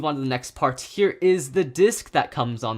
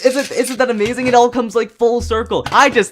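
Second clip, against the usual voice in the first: excited; 7 semitones higher, 8 dB louder than usual. Real, not acted. Thrilled by the Super King discovery.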